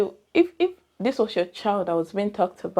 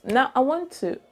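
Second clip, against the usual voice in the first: ordinary talk, nothing marked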